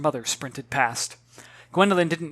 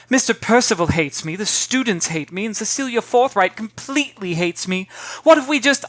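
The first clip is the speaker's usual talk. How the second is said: put-on girl complaining; 6 semitones higher, 5 dB louder than usual.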